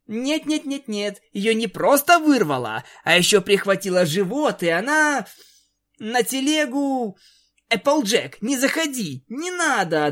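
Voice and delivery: frantic. A narrator's reading. Rainbow frantically blocks Applejack.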